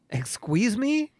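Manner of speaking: hammed-up offended gasp; mock indignation. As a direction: feign shock at the accusation.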